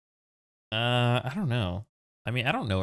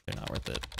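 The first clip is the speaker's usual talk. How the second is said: whispered; 12 semitones lower, 8 dB quieter, faster than usual. Real, not acted. Whispered aside.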